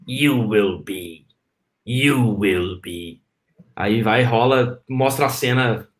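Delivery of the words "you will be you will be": put-on low, very slow little old master; Yoda voice. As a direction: draw each word out low and slow like a wise old alien. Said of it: "Aí o Yoda fala assim".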